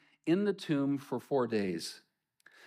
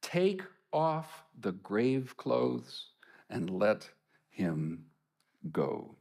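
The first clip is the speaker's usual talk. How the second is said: slow commanding Jesus; 2 semitones lower, much slower than usual. Acted. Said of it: "Jesus said to them".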